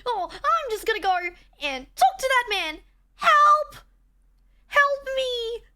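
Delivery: put-on high, loud, erratic girl voice; extra Emily voice. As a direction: yelp for help like a frantic anime girl. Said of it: "her one's more erratic".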